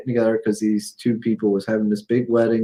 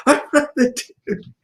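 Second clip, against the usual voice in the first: much higher mock indignation; high-pitched offended comeback, acted out; upset retold protest; 14 semitones higher than usual. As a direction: imitate someone getting worked up, pitched way up. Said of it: "she just kept getting upset".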